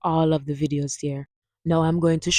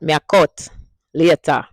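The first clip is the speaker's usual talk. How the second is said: Jamaican Patois sentence; 5 dB louder than usual. Shown as a demonstration. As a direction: demo line in Jamaican Patois. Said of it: "Hear that?".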